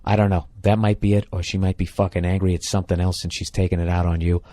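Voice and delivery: ordinary talk, nothing marked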